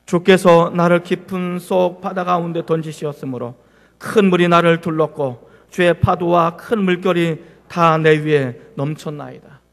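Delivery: slow, measured reading of the verse aloud; slow scripture reading. Shown as a demonstration. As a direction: read it slowly like leading the congregation in scripture. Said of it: "자, 시작". (천천히 성경 봉독하듯)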